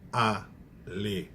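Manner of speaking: alegria split slowly into syllables; slow separated syllables on alegria; syllables split apart. Shown as a demonstration. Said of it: "Separei o I e o A".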